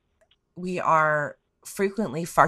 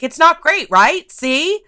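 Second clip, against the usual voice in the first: higher, louder mocking taunt; told-you-so taunt; 10 semitones higher, 7 dB louder, slower than usual. Anxious loner taunting scared newcomers, higher mocking told-you-so. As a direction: needle them with a smug little see. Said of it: "they got to go".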